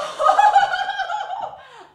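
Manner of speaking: high, loud shrieking laugh. Acted. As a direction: burst into a giddy shriek of laughter. Plump sister's laughter.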